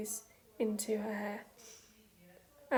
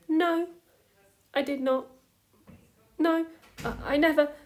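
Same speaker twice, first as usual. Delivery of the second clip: loud, higher, flustered protest; panicked denial; 5 semitones higher, 7 dB louder than usual. A narrator's reading. Hunk denying he lost the baby, loud and flustered. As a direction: blurt it out in a rush of alarm.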